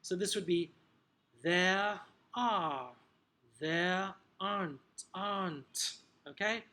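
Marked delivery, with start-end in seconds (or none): 1.4-2.9 British "there are", no r at the end of "are"; British accent. Shown as a demonstration. Teacher modelling phonetics for trainees, British accent. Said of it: "your accent, depending on where you're teaching". 3.6-5.9 slow British "there aren't", no r in "aren't"; British accent. Shown as a demonstration. Teacher showing trainees "there aren't", British accent. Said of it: "either the U K pronunciation, received pronunciation".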